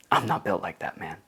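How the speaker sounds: ordinary talk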